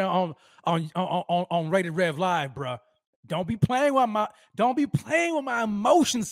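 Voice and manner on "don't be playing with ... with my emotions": high, pleading excitement; rising up, begging not to be teased; excited. Real, not acted. Vlogger to fan offering a ticket, higher and excited.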